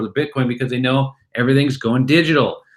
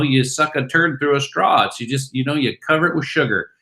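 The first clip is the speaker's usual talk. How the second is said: ordinary talk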